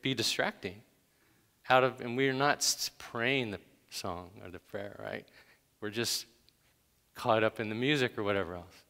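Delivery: ordinary talk, nothing marked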